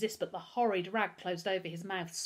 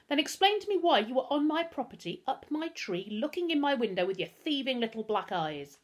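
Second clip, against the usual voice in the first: put-on higher, accusing interrogation that builds on the boy; accusing Pickering; 6 semitones higher, 5 dB louder than usual. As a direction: demand an explanation from a suspected thief.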